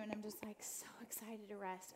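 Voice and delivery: hushed, unvoiced whisper. Telling listeners about the spa waiting room, whispering. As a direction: whisper it like you're sneaking into a quiet room.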